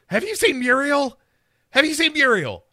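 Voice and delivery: very high, loud, put-on voice; crazy woman. Crazy woman in nightclothes asking Cam, high mock-frantic voice. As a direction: pitch it way up, pleading like a batty townswoman.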